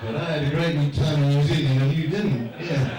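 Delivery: loud, through laughter; laughing gloat at the audience. Real, not acted. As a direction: crow over it with a laugh. Gloating over the Australians.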